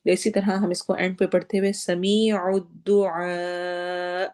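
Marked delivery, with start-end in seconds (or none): 1.7-4.3 سمیع الدعاء with a sharp catch on the final hamza; recited with a clipped glottal stop at the end. Shown as a demonstration. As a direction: recite ending with a jolt on the hamza. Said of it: "ایسے پڑھیں گے".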